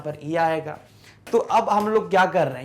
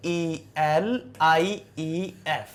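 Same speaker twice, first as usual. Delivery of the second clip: slow spelling out; 3 dB quieter, much slower than usual. Shown as a demonstration. Teacher dictating the spelling to students, very slowly. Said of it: "इसको से लिख कर देखिए".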